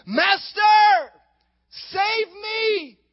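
high, panicked shout for help; desperate cry. A narrator's reading. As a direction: cry out while sinking. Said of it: "And he cried".

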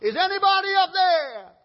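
high, loud call upward; man dangling from a branch shouts skyward; desperate cry. Acted. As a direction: yell for help from a cliff. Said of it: "he cried out loud".

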